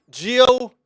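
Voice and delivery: exasperated. Real, not acted. Drawn-out exasperation at pick.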